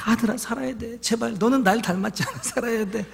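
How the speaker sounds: 숨죽여 흐느끼며 조용히 애원; 목이 메어 점점 잦아드는 울음 섞인 부탁; tearful plea. Retold or imitated. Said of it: "막 엄청 울었어요". (꿈속 아들에게 흐느끼며 속삭이듯 애원하는 아빠)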